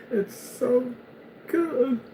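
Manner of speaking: high mock sob. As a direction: wail it out in high fake tears. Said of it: "you just start crying".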